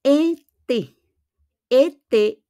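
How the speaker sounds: "ete" in two drawn-out syllables, both closed "ay"; accent aigu. Shown as a demonstration. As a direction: pronounce "ete" slowly as "ay-tay". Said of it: "Pag meron po siyang accent aigu".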